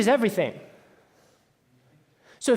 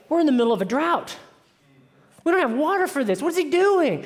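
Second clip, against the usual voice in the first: higher, fast-starting incredulous complaint; incredulous protest; 5 semitones higher, faster than usual. Acted. Crowd objects to wasting water in a drought, incredulous. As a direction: protest in rising disbelief.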